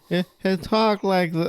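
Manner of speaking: high-pitched, put-on Southern voice; bad Capote impersonation. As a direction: attempt a high Southern talker, badly. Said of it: "such a bad impersonation".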